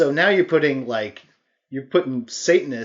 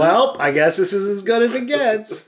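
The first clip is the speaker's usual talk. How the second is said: high-pitched, hammed-up movie line; mock title drop; 6 semitones higher than usual. Co-host joking about films saying their title, hammed-up delivery.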